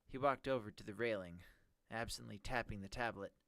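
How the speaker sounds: ordinary delivery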